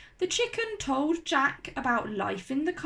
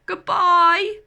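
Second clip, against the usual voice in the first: loud, higher farewell holler; 7 semitones higher, 8 dB louder, much slower than usual. A narrator's reading. The giant waves off his friends, hollering. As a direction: holler it down the beanstalk. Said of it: "hollered the giant".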